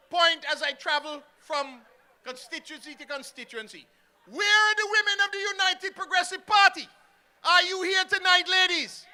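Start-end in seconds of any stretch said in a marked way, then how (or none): 4.3-9.0 loud, high call out to the crowd; rally call. Real, not acted. Minister calling the party's women in the crowd, loud and high. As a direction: shout it to the back of the rally hall.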